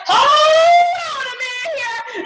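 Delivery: high, slow, drawn-out alarm of a fussy gadget; bossy ring voice. Retold or imitated. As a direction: play the ring scolding her, stretched and high. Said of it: "It was like".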